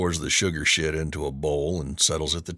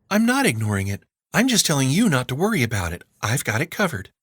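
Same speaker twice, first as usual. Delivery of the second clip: put-on breezy teen girl; 7 semitones higher, faster than usual. A narrator's reading. Girl brushing off her uncle.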